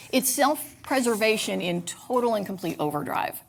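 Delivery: usual speaking voice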